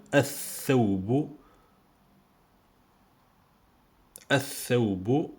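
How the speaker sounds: "Al-Thawbu" drawn out as ath-thawbu; L of "Al-Thawbu" melted into th; silent L, doubled th. Shown as a demonstration. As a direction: slowly say "Al-Thawbu" with no L and a long th. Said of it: "c'est pour le huruf shamsiya".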